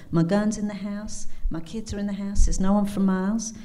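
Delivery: storytelling in an amateur Kentucky twang; bad Kentucky accent. Cabin owner tells of facing a mountain lion, Kentucky accent. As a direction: play the Kentucky woman sizing up her danger. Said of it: "my very bad Kentucky accent".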